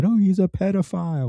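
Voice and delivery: high, put-on voice of a whiny critic; mocking critic. Mocking the critics to his viewers in a high put-on voice. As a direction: sneer it out like a mocking opponent. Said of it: "people literally repeating to you".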